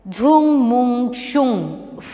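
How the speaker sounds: slow, drawn-out incantation; magic spell. Acted. Griselda doing magic on the king, slow drawn-out spell chanting. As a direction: cast the spell with long, ringing syllables. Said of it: "She does magic on him".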